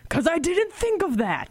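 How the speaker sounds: high, slow goofy reply. Retold or imitated. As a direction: play it dopey and innocent. Retold deadpan reply.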